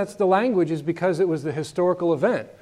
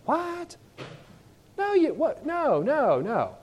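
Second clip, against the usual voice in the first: very high, baffled protests; stunned disbelief; 10 semitones higher, 3 dB quieter, slower than usual. Retold or imitated. Disciples hearing Jesus must go to the cross, shocked and high. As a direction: splutter in disbelief. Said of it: "And the disciples went".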